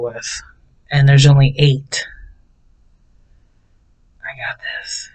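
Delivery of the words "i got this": whispered to herself. Retold or imitated. Vlogger whispering self-talk on arriving at the tiny store. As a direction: breathe it out as a private reassurance.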